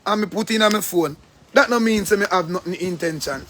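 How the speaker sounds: usual speaking voice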